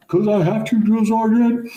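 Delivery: high, loud mock-recruit holler; Forrest Gump. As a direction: shout it as a comically eager, obedient recruit. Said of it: "totally like Forrest Gump".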